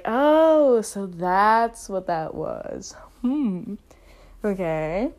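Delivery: slow, mock lightbulb moment; dawning realization. Podcaster recalling figuring out labels, dawning realization.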